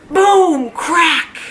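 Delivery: big loud sound words. Acted. Reading the big crash words to children, loud and high. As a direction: boom it out for the big letters. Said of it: "That's why I'm reading them so loud".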